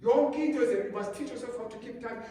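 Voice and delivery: normal talking voice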